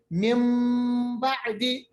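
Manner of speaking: من بعدی sounded mim ba'di; n turned to m. Shown as a demonstration. Teacher models the iqlab in من بعدی. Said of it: "مگر ہم پڑھتے ہیں".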